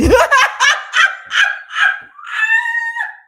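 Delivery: high shrieking laugh at a comment; loud laughter. Real, not acted. Host cracking up at a viewer comment, shrieking laugh. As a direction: burst out laughing hard.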